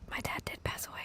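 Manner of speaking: soft breathy mock-ASMR whisper. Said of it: "This is an A S M R podcast".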